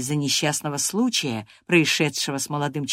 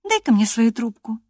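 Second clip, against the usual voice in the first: Catherine's voice; 6 semitones higher, 3 dB louder than usual. Acted. Catherine demanding his pipe.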